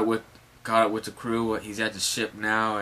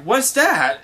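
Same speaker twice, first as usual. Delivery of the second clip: high, loud exclaimed question; startled question; 11 semitones higher, 5 dB louder than usual. Acted. Crew sees the newcomer appear, startled. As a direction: blurt it in mock astonishment. Everyone asks in astonishment.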